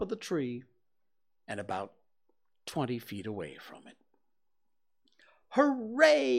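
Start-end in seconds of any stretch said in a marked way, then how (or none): 5.5-6.4 high, loud cheer; boy cheering loudly as the balloon rises; shout. Acted. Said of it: "you shouted".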